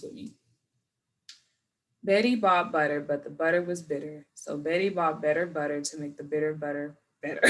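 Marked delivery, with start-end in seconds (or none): 2.0-7.4 quicker, smooth tongue twister; smooth read. Shown as a demonstration. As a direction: read it straight through. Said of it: "I'm just gonna read".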